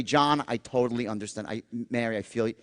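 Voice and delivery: soft, low sympathetic voice; empathy tone. Shown as a demonstration. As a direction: sound like you feel their pain. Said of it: "you felt empathy".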